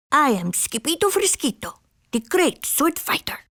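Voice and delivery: high, proud Spanish-flavored boast; boasting kitten hero. A narrator's reading. Kitten introduces himself to fox, high boasting voice.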